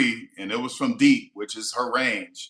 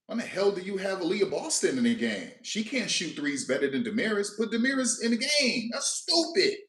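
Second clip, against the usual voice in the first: rant, getting more worked up; indignant rant; 6 semitones higher than usual. Real, not acted. Fan ranting at the coach over the lineup, rising indignation. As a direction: pitch climbing higher and higher, fast.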